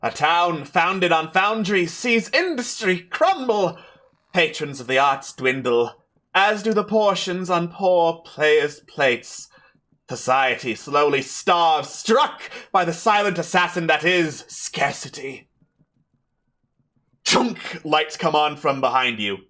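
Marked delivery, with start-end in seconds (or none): 0.0-15.5 slow, declaimed prologue with swooping pitch; theatrical stage announcer. Acted. Unseen host narrates the town's tragedy, theatrical declaiming. 17.2-17.8 high, abrupt switch noise; spotlight clunk. Acted. Game master imitates lights coming on behind players, high clunk.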